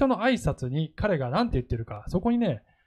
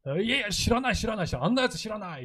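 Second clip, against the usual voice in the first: panicked denial; 6 semitones higher, faster than usual. Retold or imitated. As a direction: brush it off like a cornered Peter. Peter denies knowing Jesus.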